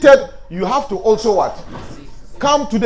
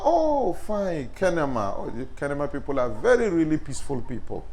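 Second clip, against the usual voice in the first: soft flattering praise; 9 semitones lower, 10 dB quieter than usual. Shown as a demonstration. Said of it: "Oh, this is my name".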